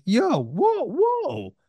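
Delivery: high, startled whoa whoa. Retold or imitated. Retelling the moment an inner part flipped him off, high startled whoa.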